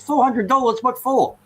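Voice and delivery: high, loud, incredulous question; outraged disbelief. Acted. Dog owner questioning the vet's bill, shocked and indignant. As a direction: voice leaps up in acted indignation.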